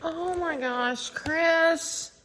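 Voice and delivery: usual speaking voice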